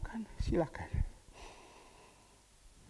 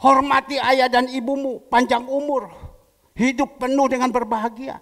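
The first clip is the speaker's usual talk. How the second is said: much louder, higher proclamation; booming, higher scripture proclamation; proclaimed scripture; 6 semitones higher, 13 dB louder than usual. Real, not acted. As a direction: declare the verse to the whole congregation. Proclaiming commandment loudly.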